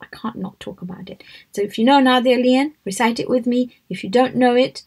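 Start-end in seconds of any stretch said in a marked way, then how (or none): 0.1-1.2 whispered aside to herself; hushed inner thought. Retold or imitated. Speaker recalling her private thought, whispered. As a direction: breathe it out as a secret thought. Thinking aloud about the talk.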